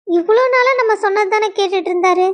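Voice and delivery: normal talking voice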